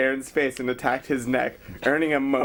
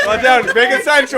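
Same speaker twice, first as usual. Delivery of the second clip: loud instruction; 7 semitones higher, 9 dB louder than usual. Real, not acted. Directing the reader.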